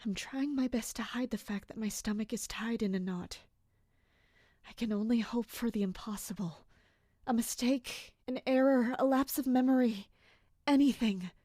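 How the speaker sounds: soft, hushed thoughts of dread; inner monologue. Acted. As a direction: whisper your worries to yourself.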